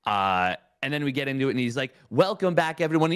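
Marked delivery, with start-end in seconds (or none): 2.1-3.1 high, loud caster voice; Roddy the commentator. Retold or imitated. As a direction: snap into a bright caster welcome. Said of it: "possessed by Roddy, the commentator".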